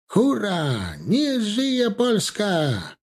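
high, drawn-out battle cry; war cry. Acted. Polish soldier charges out of the crowd, war cry. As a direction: cheer your cause in a high, stretched call.

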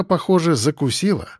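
plain reading voice

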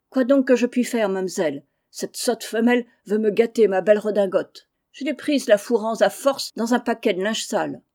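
loud quarrel with his wife; angry shouting. Acted. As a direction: bawl him down in a spat. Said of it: "criant à qui mieux mieux". (mari qui crie contre sa femme)

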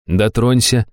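plain reading voice

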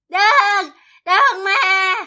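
high, loud wailing plea; acted crying, drawn out and pitched high; tearful begging. A narrator's reading. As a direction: cry out in panicked, sobbing protest. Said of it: "khóc lóc nói".

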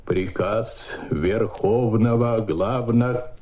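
drawn-out, unhurried announcer read; slow unhurried reading. Shown as a demonstration. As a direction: stall for time by reading slowly. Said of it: "первую фразу или две читаем, не торопясь".

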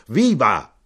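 loud, high cheer; shouting. A narrator's reading. Knife-grinder cheering in the tavern, shouting. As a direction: burst out with a cheer. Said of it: "l'arrotino gridò di nuovo".

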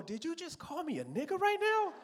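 very high disbelieving voice; can't believe what you heard; mock-shocked squeak; shocked question. Shocked reply to cop.

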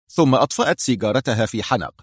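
ordinary delivery, nothing marked

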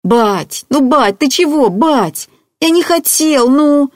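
high, loud, whining son; babbling plea. A narrator's reading. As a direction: plead with your father like a childish grown son. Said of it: "бубнил Петька".

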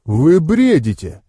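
drawn-out indignant challenge; defiant outburst. A narrator's reading. Man slamming the table at his host, defiant. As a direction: throw it down as a challenge. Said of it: "с вызовом уставился на".

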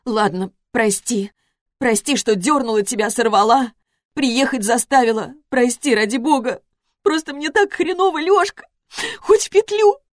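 apologise, breaking toward sobs; tearful drunk apology. Acted. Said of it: "снова расплакалась".

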